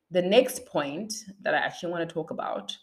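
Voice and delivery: ordinary talk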